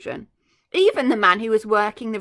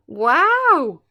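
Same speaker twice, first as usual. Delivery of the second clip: louder, drawn out amazement; amazed wow; 4 semitones higher, 9 dB louder than usual. Real, not acted. Reader reacting to the crocodile's fate, amazed wow. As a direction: gasp out the wonder.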